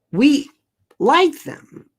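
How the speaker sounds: high and loud, insisting; emphatic. Real, not acted. Comic telling the crowd it didn't matter: emphatic. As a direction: push the point hard and high.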